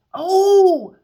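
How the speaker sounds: high, loud burst of delight; excited oh. Real, not acted. Reader suddenly delighted mid-reading, high loud oh. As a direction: gasp it out thrilled.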